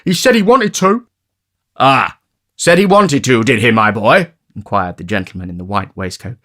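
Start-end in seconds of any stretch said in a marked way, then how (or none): 0.1-1.1 put-on high, sly charity boy; high, smug schoolboy tattling; Noah's voice. A narrator's reading. 1.8-4.3 put-on a touch of a pompous old gentleman. A narrator's reading.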